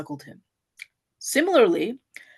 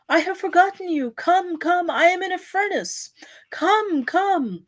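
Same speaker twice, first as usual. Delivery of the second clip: higher, longing plea with a touch of weeping; crying out to God; 6 semitones higher than usual. A narrator's reading. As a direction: call out to the Lord like someone burning with longing. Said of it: "He cries".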